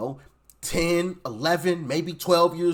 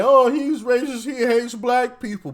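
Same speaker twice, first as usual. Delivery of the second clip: mock critic voice; 5 semitones higher than usual. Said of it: "who are gonna say".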